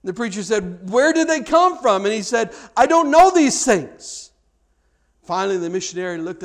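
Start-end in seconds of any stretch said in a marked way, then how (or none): 0.9-2.0 high, loud indignant demand; fuming preacher. Retold or imitated. Young preacher demanding answers of the missionary, fuming. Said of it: "this young preacher was just fuming". 2.8-4.3 high, loud, drawn-out reply; raised-voice retort. Retold or imitated. Old missionary answering the preacher's questions, loudly insistent.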